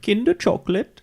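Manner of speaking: high squeaky cartoon boy voice; Dutch Boy voice. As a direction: pitch way up like a cartoon kid. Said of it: "in the Dutch Boy voice".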